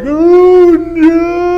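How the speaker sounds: high, loud, drawn-out cry of dread; big hammed-up dismay; acted horror. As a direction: wail it as the crash unfolds. Acts his horror at the crash.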